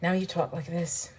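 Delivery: a soft put-on British accent; playful fake British accent. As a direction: drop into a quiet mock British accent. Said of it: "now you have a British accent".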